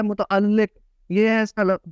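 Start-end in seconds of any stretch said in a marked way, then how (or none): 0.0-0.8 متعلق said as mu-ta-al-liq; zer under laam. Shown as a demonstration. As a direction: end متعلق on "liq", doubled l. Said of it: "اس کا تلفظ کیا ہے". (متعلق میں لام مشدد، نیچے زیر)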